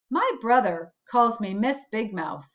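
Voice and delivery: usual speaking voice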